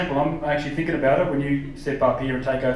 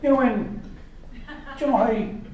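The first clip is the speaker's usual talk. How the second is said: higher, rambling questions that trail into laughter; a lightly voiced, meandering Irish comic, laughing; Jimeoin impression; 5 semitones higher than usual. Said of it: "Jamoan could run Ireland, you know, and be like".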